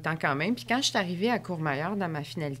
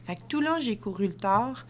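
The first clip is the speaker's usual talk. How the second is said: ordinary talk, nothing marked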